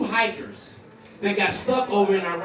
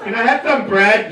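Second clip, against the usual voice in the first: helpless husband; 6 dB louder than usual. Acted. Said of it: "This is me. You're like".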